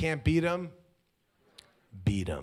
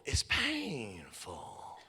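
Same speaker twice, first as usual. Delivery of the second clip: drawn out very slowly and softer; slow pained; 2 semitones lower, 7 dB quieter, much slower than usual. Shown as a demonstration. Preacher dwelling on the hurt, very slowly. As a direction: stretch it out as if it hurts.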